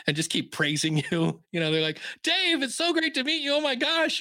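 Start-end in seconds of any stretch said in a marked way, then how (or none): 2.2-4.2 high, hammed-up fan gush. Fans at a podcast event fawning over Dave, high mock gush. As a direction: mimic starstruck fans fawning.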